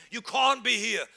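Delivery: ordinary talk, nothing marked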